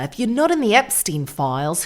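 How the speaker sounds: mock Australian accent. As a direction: mimic an Australian socialite. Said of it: "She does sound Australian to me".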